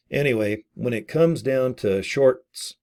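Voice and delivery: usual speaking voice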